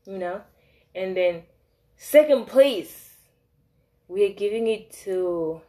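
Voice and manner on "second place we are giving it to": drawn-out award-show call; mock announcer. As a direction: announce it like a prize, stretched out. Announcing her ranking of stories.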